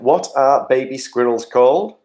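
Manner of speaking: normal talking voice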